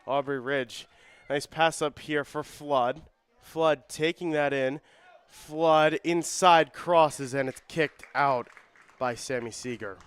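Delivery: urgent match commentary; live play-by-play. Real, not acted. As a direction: call the attack as it builds, slowly and loudly.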